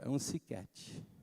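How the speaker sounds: put-on lisping toddler saying his words wrong; small child mumbling, slow and soft; toddler talk. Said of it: "daquele jeito de criança falar".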